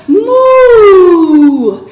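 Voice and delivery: loud, held playful moo; cow moo. Shown as a demonstration. Teacher leading kids in cow pose, loud playful moo. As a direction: moo like a big milk cow. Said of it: "what sound does the cow make?".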